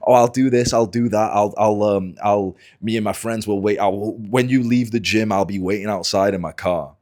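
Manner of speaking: mock blustering threats. Angry gym guy threatens to wait outside, mocked blustering rant. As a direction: stumble through big empty threats, mocking him.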